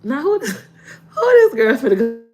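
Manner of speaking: high, tearful whining; crying kid voice. Retold or imitated. Little sister crying to her big sister, teary and high. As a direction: sob through the question like a kid. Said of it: "Let me stop crying".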